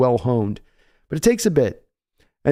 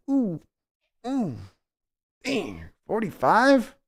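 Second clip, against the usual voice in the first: high, hushed, slow ooh of alarm; dismayed high whisper of shock; mock wince; 7 semitones higher, 5 dB quieter, slower than usual. Reacting to news, cheeks tightening.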